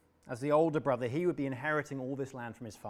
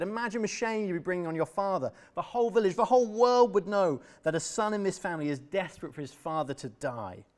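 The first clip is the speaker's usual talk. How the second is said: indignant rebuke; 5 semitones higher, 6 dB louder than usual. Acted. Adam indignant at brother.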